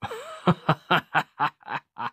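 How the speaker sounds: high laugh tumbling downward; amused villain laugh. Acted. Villain amused by a captive's answer, mocking laugh.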